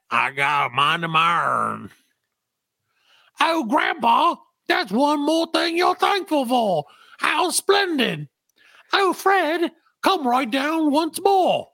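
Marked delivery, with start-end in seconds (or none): 3.4-11.7 put-on much higher, sing-song young woman teasing; high girlish voice. Ada mocking grandfather about being thankful, high teasing girl's voice. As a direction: tease grandpa sweetly, pitched up high.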